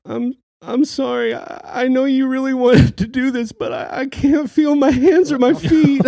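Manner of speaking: put-on high, apologetic small-boy voice; ashamed child who can't take the cold; sorry little boy. Freezing son apologizes to dad.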